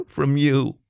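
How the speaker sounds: a touch of a break, lifted pitch. A narrator's reading. Topher offering the ring to LaShonda, voice breaking a little. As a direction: let emotion catch slightly on the words. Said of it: "His voice broke as he said it".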